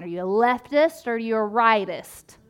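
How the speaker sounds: ordinary talk, nothing marked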